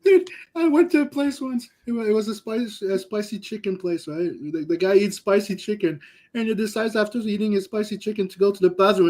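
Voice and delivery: normal talking voice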